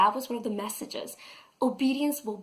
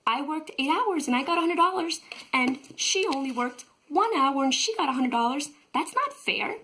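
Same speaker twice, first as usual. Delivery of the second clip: high, louder indignant complaint; outraged Mike; 6 semitones higher, 4 dB louder than usual. Retold or imitated. Mike complaining to the boss about equal pay, high and indignant. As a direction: squeal it out as a sheer injustice. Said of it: "He's like".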